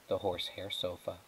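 plain reading voice

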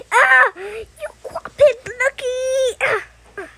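high, loud play-acted wail; mock outraged cry. Rival raging at lucky seven, mock wailing. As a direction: cry out as the loser.

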